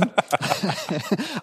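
soft laugh. Real, not acted. Laughing after coughing.

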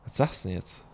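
low, soft inner question. Retold or imitated. Man quietly asking himself what to say, soft and low.

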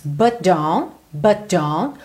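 "But don't" run together, T of "But" merged into the D; linked T and D. Shown as a demonstration. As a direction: join "But don't" as one, "buh-don". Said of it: "você vai ligar esses dois sons". ("But don't" com os dois sons ligados)

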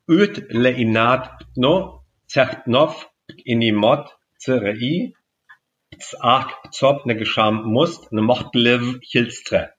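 reversed German phrase, spoken slowly; backwards speech. Shown as a demonstration. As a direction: sound out the sentence backwards, syllable by syllable. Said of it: "das klingt ungefähr so".

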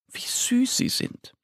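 high, soft whispered aww; breathless whisper of delight; whispered cooing. Acted. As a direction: melt over cute piglets. Narrator coos at piglets.

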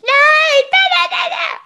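very high, loud whiny no; protesting toddler. Shown as a demonstration. Toddler refuses to get in the car, very high whining. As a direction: wail the refusal like a small child. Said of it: "Willst du nicht?".

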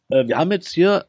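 usual speaking voice

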